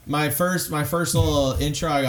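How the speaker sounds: usual speaking voice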